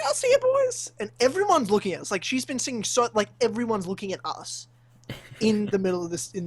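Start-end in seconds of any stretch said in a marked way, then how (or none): 0.0-0.9 very high, singsong goodbye; mimicked woman's farewell. Woman boarding tram calls to the boys, high mimicked farewell. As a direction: trill it out as the departing woman.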